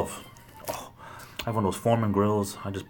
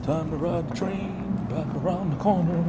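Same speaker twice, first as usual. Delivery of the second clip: put-on much higher, slow childlike singsong; playful singsong; 9 semitones higher, slower than usual. Narrating the walk as a train ride, playful singsong. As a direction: sing it like a toy train announcer.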